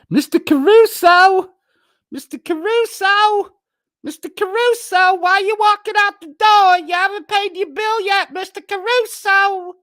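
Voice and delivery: high, loud sing-song call after someone leaving; hollering a mock-sweet singsong after a customer. Calling after Mister Caruso as he dashes out, high singsong.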